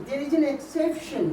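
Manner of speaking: very slow, deliberate English for the class; teacher slowing right down on the English line; slow English emphasis. Shown as a demonstration. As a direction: spell out each word slowly for students. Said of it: "একটা ব্যতিক্রম আছে".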